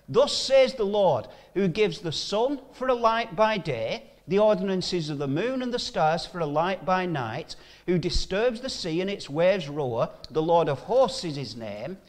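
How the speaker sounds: forceful Bible reading; scripture reading. Acted. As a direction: proclaim the verses to the congregation. Preacher reads scripture aloud.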